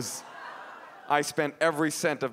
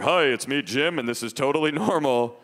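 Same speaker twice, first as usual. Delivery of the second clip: lightly disguised man's voice; fake grown-up voice. Boy posing as Jim on the phone, put-on adult voice. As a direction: pass yourself off as an adult, overly casual. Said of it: "you can't use your real voice".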